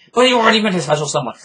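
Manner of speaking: loud, high and rushed; fast, incredulous protest; exasperated outburst. Real, not acted. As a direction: burst out with the objection all at once. Exasperated objection to the moral.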